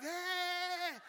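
high happy dog voice; a dog answering cheerfully; dog's happy hey. Pretend. Dog replying to its insulting owner happily. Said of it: "that dog will be like".

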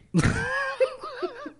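sudden high laugh; real laughter; high giggle. Real, not acted. Laughing at message.

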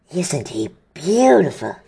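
slow, lightly voiced doting giant; a hint of Hagrid, adoring; Hagrid muttering. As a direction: admire the baby dragon, slowly. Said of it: "Hagrid muttered".

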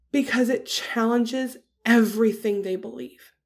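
ordinary talk

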